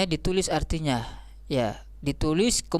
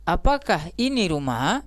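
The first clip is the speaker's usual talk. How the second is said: "Apakah ini rumah" with "ini" lifted and "rumah" rising; question intonation. Shown as a demonstration. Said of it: "itu kan istifhamiyah". (intonasi bertanya, "rumah" naik di akhir)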